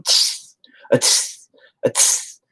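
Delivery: sharp hissed "tss" bursts; imitated spurting noise. Dad mimicking his son's spurting blood, sharp hiss. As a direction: imitate blood squirting in short hissing spurts.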